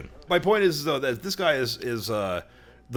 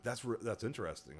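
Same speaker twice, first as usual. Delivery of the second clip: softly musing, much quieter; Joe Rogan; 14 dB quieter than usual. Retold or imitated. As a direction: muse it quietly, badly imitating a podcaster. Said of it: "I can't do a good Joe Rogan impersonation".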